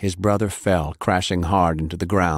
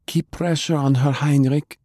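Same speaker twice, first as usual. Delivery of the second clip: higher, brisk instruction; lightly voiced brisk healer; 8 semitones higher than usual. A narrator's reading. Healer ordering Heinrich while working, higher and brisk.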